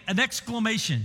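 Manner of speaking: ordinary delivery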